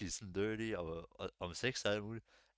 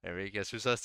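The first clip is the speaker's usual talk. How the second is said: usual speaking voice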